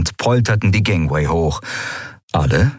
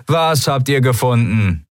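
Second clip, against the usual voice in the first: higher-pitched, irritated call back; annoyed call; 6 semitones higher than usual. A narrator's reading. Pirate answering the shouting Skria, annoyed call. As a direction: ask it with impatience, calling across. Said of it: "rief jemand genervt".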